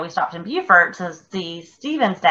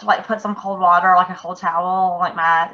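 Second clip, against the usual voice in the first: normal talking voice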